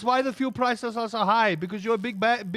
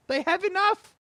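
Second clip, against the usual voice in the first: much higher, squeaky voice on enough, rising at the end; exasperated squeak; 12 semitones higher than usual. Acted. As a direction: squeak it out in disbelief. Mocking the greedy rich.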